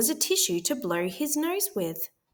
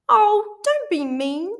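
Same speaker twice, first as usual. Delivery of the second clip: high, louder sniffly begging; sniffling plea; 12 semitones higher, 7 dB louder than usual. A narrator's reading. Sick wolf begs the pig for a tissue, sniffling. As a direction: beg through a runny nose. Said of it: "sniffled Big Bad".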